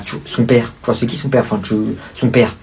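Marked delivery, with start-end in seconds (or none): none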